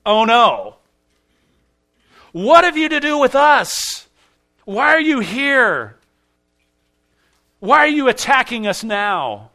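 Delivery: high, loud panicked demon pleading, slow on why; terrified demon. Acted. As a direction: cry out like a cornered demon dreading doom. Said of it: "This is fear".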